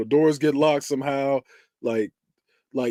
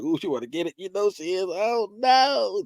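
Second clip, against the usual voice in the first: dreading viewer; 6 semitones higher, 3 dB quieter than usual. Acted. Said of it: "That's how you watching it".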